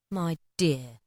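soft, gentle appeal; calm her gently; mild answer. A narrator's reading. Bob calming his wife, mild and soft. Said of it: "Bob's mild answer".

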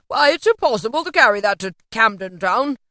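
a touch of excited exclamation; delighted Scrooge. A narrator's reading. Scrooge gleefully orders the turkey.

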